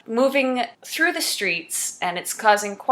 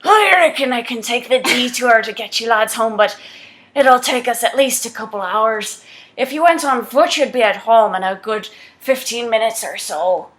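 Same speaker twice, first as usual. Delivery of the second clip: slightly louder, put-on English driver voice; attempted English accent; 3 dB louder than usual. Driver offering the lads a ride home, English accent. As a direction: have a go at a working English accent. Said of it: "Let me try that".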